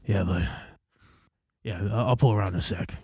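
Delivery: put-on low, soft ninety-year-old voice; old man. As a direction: play a feeble old fellow offering help. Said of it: "ninety fucking year old man".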